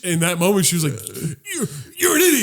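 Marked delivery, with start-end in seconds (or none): 1.5-2.4 put-on high, shrill-pitched girl voice calling him an idiot; mock girlfriend insult. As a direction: squeak it out as the angry girlfriend.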